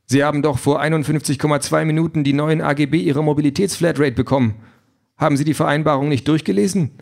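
the car apologetically throws him out, all politeness; overly courteous AI car, regretful; polite car voice. Acted.

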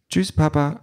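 put-on high little-girl goodbye; child's voice. A narrator's reading. Child says goodbye to papa on leaving, childlike. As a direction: say farewell in a small child's high voice. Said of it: "sagt das Kind".

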